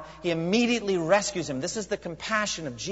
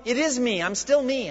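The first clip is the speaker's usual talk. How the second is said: high, loud insistent reassurance; firm reassurance; 9 semitones higher, 6 dB louder than usual. Acted. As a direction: insist he's still here. Voicing Jesus' reassurance.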